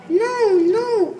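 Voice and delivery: loud, high protesting cry. A narrator's reading. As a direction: shout it out in protest like a child who won't go back to bed. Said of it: "cried Froggy".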